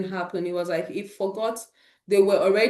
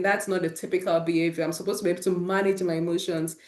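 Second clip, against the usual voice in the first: ordinary delivery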